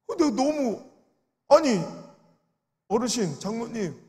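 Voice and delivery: retold outraged protest to an elder; indignant rebuke. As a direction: push back hard at the elder's words.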